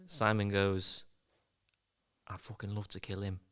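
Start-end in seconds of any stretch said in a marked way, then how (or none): 2.3-3.4 quiet menace. Acted. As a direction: say it under the breath, menacing. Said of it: "talking quietly from a distance".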